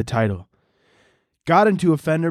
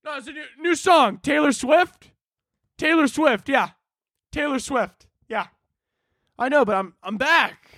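nervous coworkers; 11 semitones higher than usual. Retold or imitated. Coworkers dodging the returning COO.

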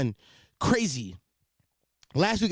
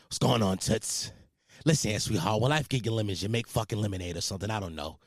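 Irish Italian voice; 6 semitones lower, 4 dB quieter than usual. Acted. Recalling a silly phone bit with a friend, Irish-Italian voice. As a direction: drop low into a comic Irish-Italian accent. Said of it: "Irish Italian voice".

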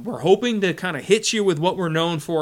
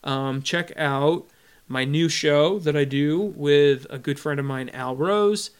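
ordinary delivery

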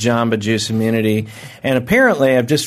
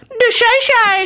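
very high falsetto yelp; falsetto yell; 3 dB louder, slower than usual. Shown as a demonstration. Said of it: "I yell out".

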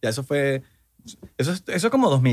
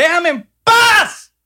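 high, loud, slow sobbing yell; hammed-up despair, begging for peace; mock crying shout; 14 semitones higher, 7 dB louder, much slower than usual. Host fake-sobbing at a crypto pitch to leave him alone, loud and high.

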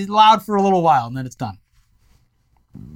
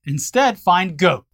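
ordinary delivery

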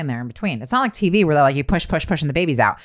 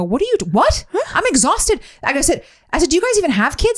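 exasperated retort; 12 semitones higher than usual. Retold or imitated. Mother in labour snapping at the midwives, high and incredulous. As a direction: throw it back at them in disbelief.

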